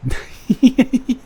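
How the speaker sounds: a bright burst of real laughter, pitched high; joyful high laugh. Real, not acted. Just after she accepts his proposal, a high happy laugh. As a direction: laugh out loud with relief and joy.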